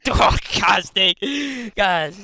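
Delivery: loud, high, slow groaning curses; hurt cursing. Acted. Archer on the floor curses the halfling, loud and pained. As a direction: grumble through the pain.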